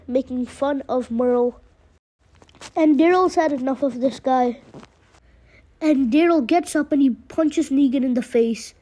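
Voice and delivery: ordinary delivery, nothing marked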